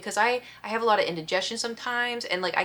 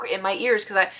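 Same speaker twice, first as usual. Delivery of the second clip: ordinary delivery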